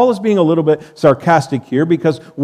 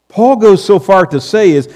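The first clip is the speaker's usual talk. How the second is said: ordinary talk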